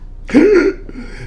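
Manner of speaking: sharp high gasp of horror; shocked gasp. Acted. Scrooge gasping at the children under the robe. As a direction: gasp at what the spirit shows.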